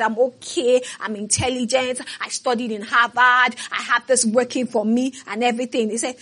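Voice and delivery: usual speaking voice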